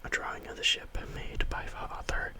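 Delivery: ordinary delivery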